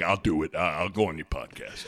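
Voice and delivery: low, quiet coach voice; Coach Self impression. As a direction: drop deep and soft as the coach. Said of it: "Coach Self's like".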